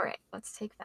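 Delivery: whispered aside, no voice. Real, not acted. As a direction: whisper it off-mic to your co-host. Quick aside during podcast.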